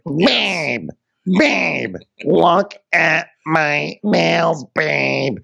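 high, slow, crying mock whine, babe drawn out. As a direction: whimper it like a spoiled wife showing off her nails. [name] voiced mocking Jacqueline.

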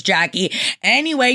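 normal talking voice

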